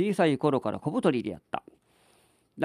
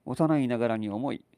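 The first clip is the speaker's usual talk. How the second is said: usual speaking voice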